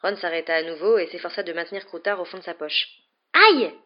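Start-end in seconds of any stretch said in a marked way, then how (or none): 3.3-3.7 high, loud yelp of pain; sudden outraged high-pitched ouch; pained exclamation. A narrator's reading. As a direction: cry out as if just bitten. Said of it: "s'exclama-t-il".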